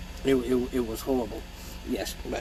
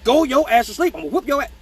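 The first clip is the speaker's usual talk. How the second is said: yelling; 8 semitones higher, 4 dB louder, faster than usual. Retold or imitated. Dad yelling at kid.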